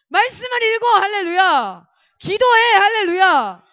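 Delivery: 높고 크게 끊어 외치는 명령; 학생들에게 목청껏 촉구; 설교조 외침. Acted. (힘차게 내지르는 권면)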